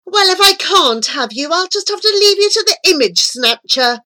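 loud, high furious tirade; angry scream. A narrator's reading. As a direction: scream the threat in rage. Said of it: "the angel screamed".

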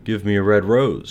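cried. A narrator's reading. Nightingale asks the second tree, crying out. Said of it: "she cried".

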